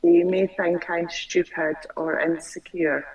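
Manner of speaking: ordinary delivery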